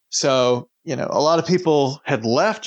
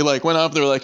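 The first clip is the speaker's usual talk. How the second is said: ordinary talk, nothing marked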